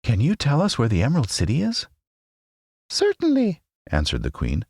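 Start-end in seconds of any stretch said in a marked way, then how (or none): 0.0-1.9 put-on girl's voice. A narrator's reading. Dorothy voiced. 2.9-3.6 very high squeaky mouse queen; squeaky little voice. A narrator's reading. Queen of the Mice greeting her friends, squeaky little voice. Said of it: "in her squeaky little voice".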